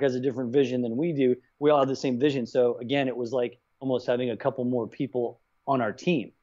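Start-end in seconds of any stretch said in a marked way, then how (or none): none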